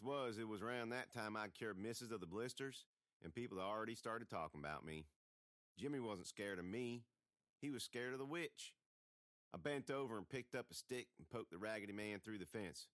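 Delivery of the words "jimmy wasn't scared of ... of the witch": ordinary delivery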